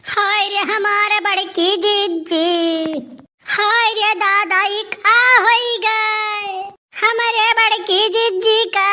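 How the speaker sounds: slow, high, half-sung wail; hammed-up keening, drawn out and high; mock wailing lament. Villager wails over the possessed sister, sung mock lament.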